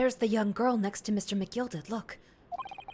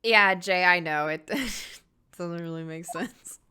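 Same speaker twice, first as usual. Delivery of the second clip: usual speaking voice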